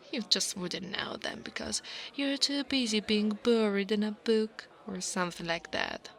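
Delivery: ordinary talk, nothing marked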